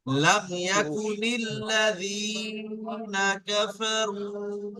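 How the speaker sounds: slow phrase-by-phrase recitation; staccato. Shown as a demonstration. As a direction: recite slowly, stretching the vowels. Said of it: "এখানে টান দেবেন".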